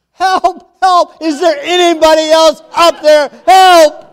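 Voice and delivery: high, loud frantic cries for help; yelling for help. Acted. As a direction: shout for anyone else up there. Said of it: "Jack yelled".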